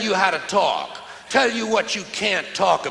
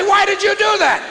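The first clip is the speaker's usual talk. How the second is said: higher, louder, accusing yell; shouted demand; 12 semitones higher, 6 dB louder than usual. Retold or imitated. Comic confronting the burglar, shouting. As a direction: yell the question at him. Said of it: "I want to say".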